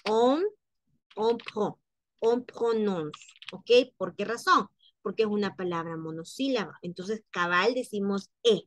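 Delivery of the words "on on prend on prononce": "on", "on prend", "on prononce" sounded out separately; syllable-by-syllable build-up. Shown as a demonstration. French teacher showing learners the pronounced syllable, syllable-by-syllable build-up. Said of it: "en este caso sí se pronuncia".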